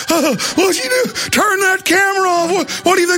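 hammed-up yelling policeman; mock angry cop. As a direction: bark it like a bullying small-town cop. Host mimics cops rushing up.

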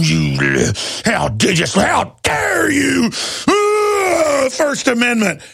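high, slow shouted outrage, mocking; mock angry cop. Crazed cop confronting protesters, hammed-up high shout. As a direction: ham up an indignant cop. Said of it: "they're walking up going".

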